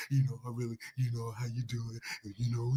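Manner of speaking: low come-on murmur in his ear; deep seductive voice. Retold or imitated. Whispering in Nate's ear at the club, low seductive voice.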